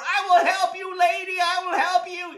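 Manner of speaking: high, loud heroic yelling; frantic rescue shout. Acted. Man drags old lady from store, frantic shouting. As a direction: shout as if hauling someone from danger.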